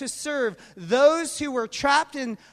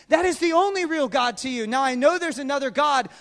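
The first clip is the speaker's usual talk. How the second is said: ordinary talk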